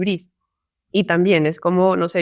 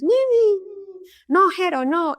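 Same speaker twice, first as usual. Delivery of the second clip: put-on very high babbling little-child voice; toddler voice; 11 semitones higher than usual. Toddler son telling his late brother no, high babble. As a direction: babble like a toddler shooing someone away. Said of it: "Y lo ves".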